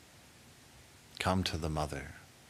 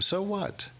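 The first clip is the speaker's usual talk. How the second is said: high, bright so what; emphatic so what; 13 semitones higher than usual. Acted. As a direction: toss it off lightly. Repeated to everyone in room.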